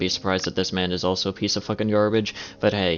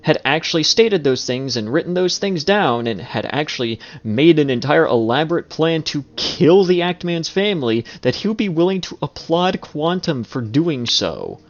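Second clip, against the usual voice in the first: heated outraged rant; 5 semitones higher, 4 dB louder than usual. Real, not acted. Commentator's outraged escalating rant.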